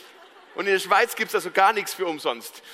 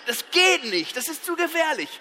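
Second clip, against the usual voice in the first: mildly hammed-up objection, pitched up at the end; indignant protest; 6 semitones higher than usual. Customer objecting to a dangerous pacifier, indignant. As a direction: protest with mock alarm.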